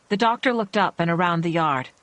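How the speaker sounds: ordinary delivery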